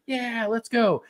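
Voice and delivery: high, hammed-up triumph; mock celebration. Golfer taking out the flag, high mock cheer. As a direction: imitate a golfer cheering himself on.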